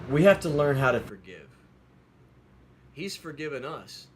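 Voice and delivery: loud, emphatic preacher delivery; preaching. Acted. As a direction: drive the question home to the congregation. Preaches on forgiveness.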